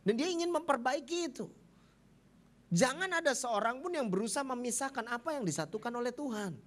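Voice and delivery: high, forceful preacher's protest; impassioned retold rebuttal. Pastor rebukes the brother, impassioned high protest. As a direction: argue back high and emphatic, as retold.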